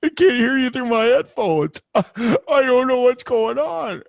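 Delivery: high, loud whiny caricature; exaggerated high-pitched helpless complaining; mocking co-host impression. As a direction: wail it in a squeaky put-on voice. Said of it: "And then you're like".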